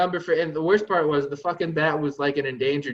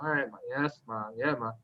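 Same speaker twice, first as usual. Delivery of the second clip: meek yes Ma; 4 semitones lower, 7 dB quieter than usual. Retold or imitated. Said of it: "I'm like".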